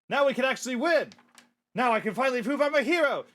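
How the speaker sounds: high, loud heroic declaration; triumphant. Acted. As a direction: proclaim it with rising excitement. Heroes rejoicing once fangirls leave.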